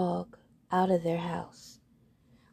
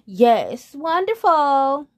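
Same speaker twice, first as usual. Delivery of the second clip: high, slow, loud chorus coo; echoing birds; 6 semitones higher, 7 dB louder, much slower than usual. Acted. Other birds echoing, high and slow. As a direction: echo the gush. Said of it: "echoed the other birds".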